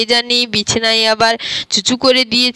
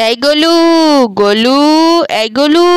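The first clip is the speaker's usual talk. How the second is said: put-on raised call to wake the boy, rising on the name; calling out loud; 3 semitones higher, 4 dB louder than usual. Mother calling loudly to wake sleeping Golu. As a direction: call his name across the room, a bit louder. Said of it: "আমি জোরে জোরে ডাকছি".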